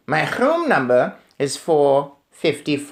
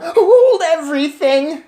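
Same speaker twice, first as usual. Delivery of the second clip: high, loud, slow witch shriek; 7 dB louder than usual. Acted. Witch stopping the meeting, loud shriek. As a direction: shriek to halt the room. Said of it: "Her shrieking voice echoed".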